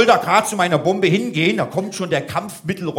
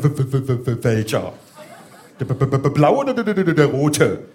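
stuttering officer; 4 semitones lower than usual. Retold or imitated. Mocking a stuttering bomb officer.

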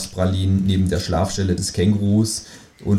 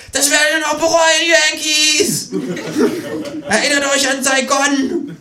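much higher defiant battle cry; shouting. Acted. Kangaroo shouting a parting threat at the staff, much higher. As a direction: yell it back through the door.

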